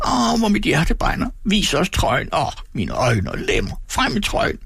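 high wailing screech, swooping down and up; shrieking old man. A narrator's reading. Old man demands the jacket from the boy, shrieking. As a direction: wail and demand in one crazed whine. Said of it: "skreg den gamle".

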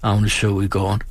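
usual speaking voice

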